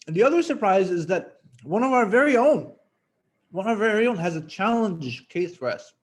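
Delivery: talking while chewing food; mouth full. Real, not acted. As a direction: speak around a mouthful. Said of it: "I shouldn't eat with my mouth full".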